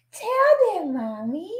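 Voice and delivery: high cooing that falls away; high sing-song pet talk. Real, not acted. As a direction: coo to the dog. Baby talk to the dog.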